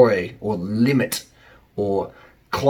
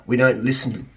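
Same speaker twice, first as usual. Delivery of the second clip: normal talking voice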